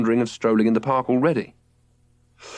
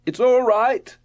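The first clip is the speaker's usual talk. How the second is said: higher, calm call from above; cheerful boy reassuring; 12 semitones higher, 3 dB louder, slower than usual. A narrator's reading. Robert from above.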